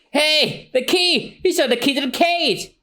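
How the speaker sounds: very high, thrilled yelling; excited shout. Acted. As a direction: shout with joy at the key. Luffy sees key.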